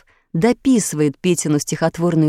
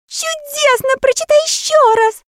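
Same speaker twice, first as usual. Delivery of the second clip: very high, delighted cries; excited cheering. A narrator's reading. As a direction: clap and cheer for more. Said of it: "хлопали в ладоши и кричали человечки".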